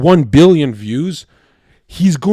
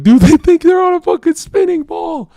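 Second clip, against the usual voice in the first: much higher, laughing through the words; mocking laughter; 5 dB louder than usual. Future listeners laughing at the podcast hosts, high mocking laugh. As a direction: squeal it out like someone crying with laughter.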